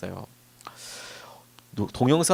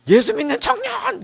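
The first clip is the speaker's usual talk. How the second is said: mock incredulous shout; 7 dB louder than usual. Man shouts back in disbelief.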